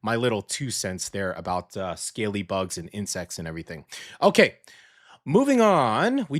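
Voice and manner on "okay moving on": upbeat transition. Real, not acted. Transition announcement.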